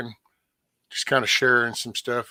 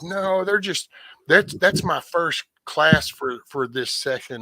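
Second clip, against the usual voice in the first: defensive mom; 6 semitones higher than usual. Retold or imitated. Mom voiced in story.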